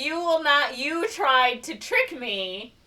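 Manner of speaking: loud, high retold scolding; mock indignant judge. Judge accuses wife of tricking him, mock indignation. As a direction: rebuke them, stretching out the accusation.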